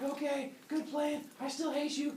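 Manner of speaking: high, called-out mock-girl voice; grudging runner. Woman accepts Zeus's plan, high grudging call. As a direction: concede it up high, still resentful. Runner answers Zeus, acted.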